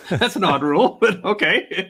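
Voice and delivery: laughing through the words; amused concession, laughter in the voice. Real, not acted. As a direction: chuckle at the absurdity as you say it. Amused by an odd rule.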